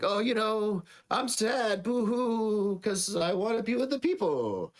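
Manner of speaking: high, whiny mock boo-hoo; fake sad. Sister angling to be sent home, fake sad. Sister feigns sadness for reward.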